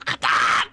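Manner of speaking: rough, sudden shout of frustration; exasperated "ah" bursting out; frustrated scream. Real, not acted. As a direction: let out a short raw yell. Frustrated scream while searching.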